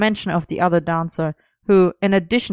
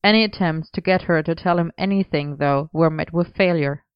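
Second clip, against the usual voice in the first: ordinary delivery, nothing marked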